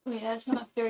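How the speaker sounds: usual speaking voice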